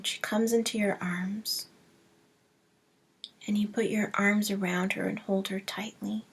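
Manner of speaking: soft, hushed meditation voice with long pauses; hushed, unhurried visualization, fading to near whisper; guided meditation. Acted. Leads a breathing meditation.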